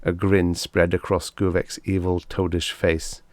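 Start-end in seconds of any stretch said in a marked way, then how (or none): none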